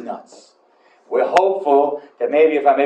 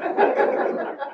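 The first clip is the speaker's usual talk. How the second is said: high burst of laughter, unvoiced; 13 semitones higher, 5 dB quieter than usual. Real, not acted. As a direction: break into a laugh. Laughs at his own story.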